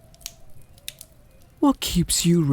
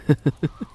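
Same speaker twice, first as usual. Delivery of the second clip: amused chuckle; 6 semitones higher than usual. Acted. Vampire teasing about starving.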